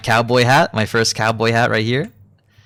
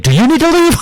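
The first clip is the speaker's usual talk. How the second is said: cheeky question; 6 dB louder than usual. Retold or imitated. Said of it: "was like".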